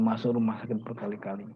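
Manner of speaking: a quiet echo of the line, sinking away; soft echo. Shown as a demonstration. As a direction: let the repeat fall to a hush.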